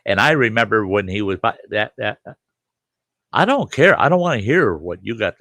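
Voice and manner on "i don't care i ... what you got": indignant retort. Retold or imitated. Snapping back at memory deniers.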